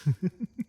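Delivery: short run of chuckles; brief, polite laugh in character; amused chuckle. Acted. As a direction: chuckle lightly to yourself. Amused at the dragon confusion.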